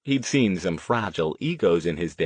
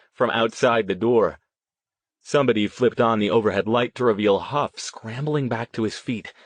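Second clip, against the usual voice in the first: plain reading voice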